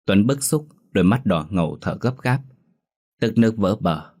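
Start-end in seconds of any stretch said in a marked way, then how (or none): none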